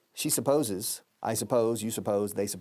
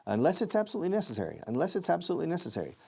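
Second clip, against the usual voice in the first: quick, fully linked delivery; native-speed linking; fast linked; 7 semitones higher, faster than usual. Shown as a demonstration. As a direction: run the words together fast. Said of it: "listen to the linking".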